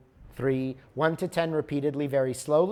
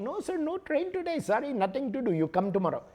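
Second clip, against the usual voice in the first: much higher, breezy clerk refusal; imitated ticket clerk; 9 semitones higher, faster than usual. Clerk refusing the desperate traveler again, high imitated voice. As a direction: shrug it off, sorry nothing to do.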